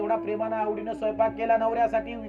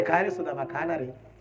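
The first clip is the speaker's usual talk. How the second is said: gentle coaxing; 6 semitones lower, 4 dB quieter than usual. Acted. Krishna coaxes Sudama to eat.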